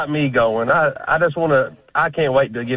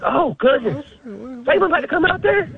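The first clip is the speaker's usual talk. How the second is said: high, breathless retold amazement; amazed; 10 semitones higher than usual. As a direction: gasp it in wonder. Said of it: "I was like".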